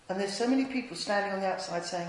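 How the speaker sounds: normal talking voice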